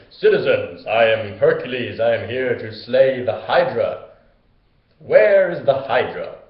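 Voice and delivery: loud, slow, grandly heroic announcement; booming hero voice. Retold or imitated. Hercules booms out to the town, loud and grand. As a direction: proclaim it like a pompous hero.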